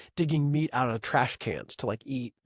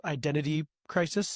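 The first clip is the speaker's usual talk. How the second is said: usual speaking voice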